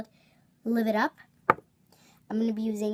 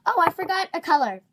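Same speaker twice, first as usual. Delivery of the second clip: surprised; 8 semitones higher, 7 dB louder, faster than usual. Real, not acted. Suddenly notices a missing color.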